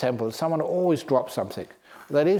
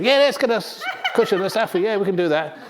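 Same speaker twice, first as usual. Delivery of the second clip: higher, louder excited monkey voice; eager monkeys; 7 semitones higher, 3 dB louder than usual. Acted. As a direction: jump at the idea like monkeys. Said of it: "monkeys respond eagerly".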